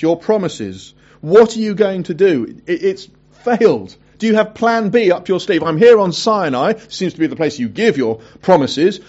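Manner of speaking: a prophet pressing God for another promise, building up; exasperated Elijah. Acted. Elijah complaining to God on Sinai, loud and pushy.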